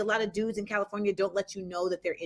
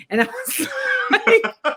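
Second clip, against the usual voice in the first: high, squealing laugh through the words; can't get it out for laughing; 14 semitones higher, 6 dB louder, much slower than usual. Real, not acted. Laughing at her date's Obama line. Laughing while retelling date.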